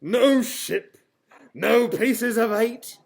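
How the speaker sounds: loud, high, hammed-up laughing sob; wailing. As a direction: blubber it like a mock-tragic pirate.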